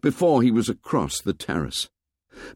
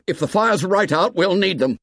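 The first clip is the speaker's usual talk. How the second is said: shouting; 8 semitones higher than usual. A narrator's reading. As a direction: call it back down the hill. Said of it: "He stopped shouting".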